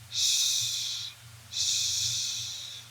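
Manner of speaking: soft whispered shh; shushing. Shown as a demonstration. Teacher shushing for a sleeping baby, soft whisper. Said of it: "The sleeping baby sound".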